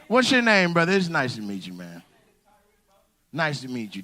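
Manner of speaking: ordinary talk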